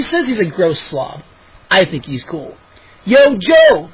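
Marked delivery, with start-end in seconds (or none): 3.0-3.9 loud, high hearty holler; bellow. A narrator's reading. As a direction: bellow a rowdy hello to a pal. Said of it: "Moose bellowed".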